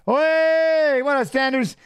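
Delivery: calling out. Real, not acted. Calling out to the fighter.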